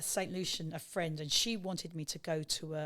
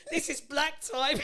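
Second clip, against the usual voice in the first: laughing quote; 8 semitones higher, 4 dB louder, slower than usual. Retold or imitated. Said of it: "I said".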